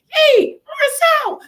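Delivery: very high squeaky mock voice; put-on voice. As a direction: pipe it up high, hammed-up and timid. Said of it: "let me put on my big voice".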